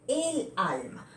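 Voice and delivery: El alma said slowly and clearly, each word apart; teacher models El alma slowly for the class; slow model phrase. Shown as a demonstration. Teacher to students, modelling the correct form slowly. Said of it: "voy a proceder a colocar el artículo el".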